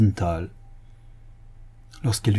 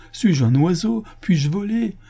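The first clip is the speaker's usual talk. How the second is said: high, woeful questions and lament; plaintive pleading; 8 semitones higher than usual. Acted. Poor honest man lamenting to the commissioner, plaintive pleading. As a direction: bemoan your helplessness.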